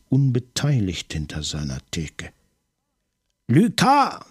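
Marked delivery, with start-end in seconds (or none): 3.5-4.2 high, loud cry for help; desperate shout. A narrator's reading. Maigret, pinned in the fight, screams for his colleague. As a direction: scream it toward the door.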